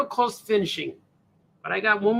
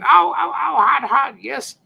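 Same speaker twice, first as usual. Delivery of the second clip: mock pained yelps; 5 semitones higher, 6 dB louder than usual. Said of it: "The Mish just burnt her tongue".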